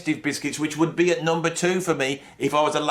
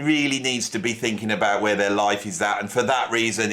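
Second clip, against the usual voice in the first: ordinary delivery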